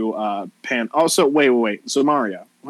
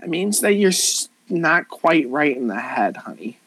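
put-on mean-girl voice, pitched up then dropping; condescending Valley girl; 11 semitones higher than usual. Valley girl explaining to a clueless newcomer, condescending. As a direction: talk down to her as a Valley girl.

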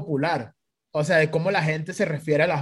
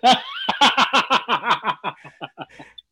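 long high cackling laugh; big laugh; 5 semitones higher than usual. Real, not acted. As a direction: burst out laughing, tailing off in chuckles. Laughing at own story. (carcajada larga y aguda)

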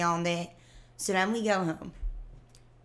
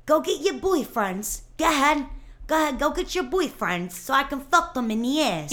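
much higher, louder tough-guy yell; mock yell; 7 semitones higher, 4 dB louder than usual. Imitating the guy's threats in a high, loud yell. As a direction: scream it like a deranged meathead.

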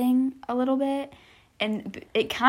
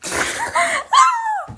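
high shrieking laugh; burst of laughter; 7 dB louder than usual. Real, not acted. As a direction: laugh out loud helplessly. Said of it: "You gotta stop making me laugh".

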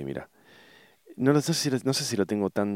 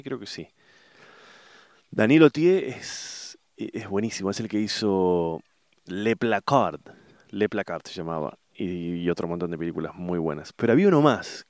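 ordinary talk

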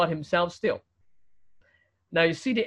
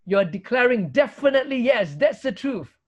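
raised, insistent declaration; emphatic yes; 5 semitones higher, 3 dB louder than usual. Shown as a demonstration. Preacher explaining what Amen declares, emphatic. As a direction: drive it home as certain truth. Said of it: "It's an emphatic yes".

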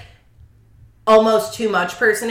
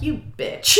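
whispered insult; 5 semitones higher, 5 dB quieter than usual. Retold or imitated. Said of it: "And I'm like".

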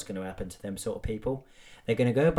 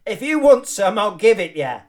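much higher, louder threat; hammed-up kebab shop challenge; mock aggressive lad; 9 semitones higher, 13 dB louder than usual. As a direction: shout it like a drunk picking a fight.

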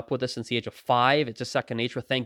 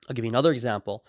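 usual speaking voice

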